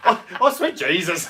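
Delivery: loud, high, laughing Southern American attempt; badly faked Southern American, breaking into laughter; botched Southern American accent. Hamming it up for co-host, failed Southern American accent. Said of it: "I don't know what accent that was".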